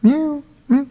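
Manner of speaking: high, thin meows; high-pitched pretend cat cry; cat imitation. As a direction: mimic a cat mewing. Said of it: "making a sound like a cat".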